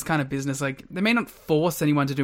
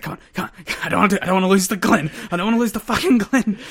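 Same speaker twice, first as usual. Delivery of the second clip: building from a hushed mutter to a louder, higher whine; psyching himself up, getting more worked up; mock frustrated rant; 4 semitones higher, 4 dB louder, faster than usual. Raven in the car, hitting his own head, mock frustrated rant.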